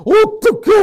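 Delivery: high, loud hammed-up wail; mock crying. Classmate crying beside the kissing men, mock wailing. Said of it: "옆에서 막 울고 있어요".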